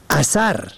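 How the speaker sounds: اثر with short a on both first letters, asar; اثر pronounced asar; short a vowels. Shown as a demonstration. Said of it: "به فتح اول و دوم".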